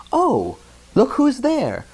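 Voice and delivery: put-on light child voice; little girl voice. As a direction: voice Madeline spotting him. Madeline spots Pepito.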